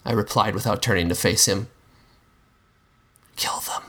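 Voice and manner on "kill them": a much softer, hushed kill them; urging whisper. A narrator's reading. The Monster softly urging the narrator to kill. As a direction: press it in low and quiet. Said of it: "he urged".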